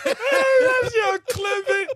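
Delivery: high, loud words through crying laughter; wheezing out words mid-laugh; laughing hard. Real, not acted. Cracking up at the clip.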